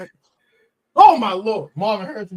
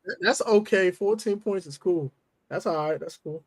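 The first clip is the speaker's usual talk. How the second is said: mock forced calm; 8 semitones lower than usual. Real, not acted. Sarcastic forced acceptance.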